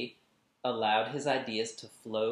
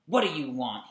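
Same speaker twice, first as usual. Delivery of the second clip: irritated, louder snap; snapped; 3 semitones higher, 7 dB louder, faster than usual. Acted. Raymond snapping at his watching sister, irritated. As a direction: snap at her, annoyed. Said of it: "he snapped".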